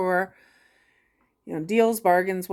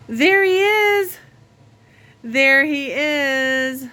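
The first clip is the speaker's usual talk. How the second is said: high, slow, delighted coo; there he is, cooed high and slow; 6 semitones higher, 7 dB louder, much slower than usual. Real, not acted. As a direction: gasp and coo at the cat up high. Greeting the cat, baby talk.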